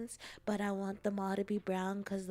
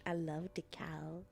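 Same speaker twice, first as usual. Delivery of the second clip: cooing softly; 2 semitones lower, 5 dB quieter than usual. Acted. Streamer cooing to chat about loving cows. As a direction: coo over the cows like a baby.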